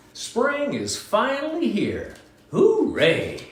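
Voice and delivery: high, slow, joyful mouse cheer; excited little mouse. Acted. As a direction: cheer spring in like a tiny mouse.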